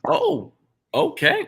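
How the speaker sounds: high surprised oh, okay. Real, not acted. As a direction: caught off guard by the answer. Surprised by guest's answer.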